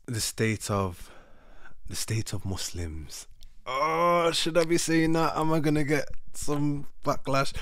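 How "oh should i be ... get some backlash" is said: high-pitched, louder fretting voice; mock-worried aside. Vlogger second-guessing a remark about Muslims, mock-worried. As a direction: fret aloud in a high put-on voice.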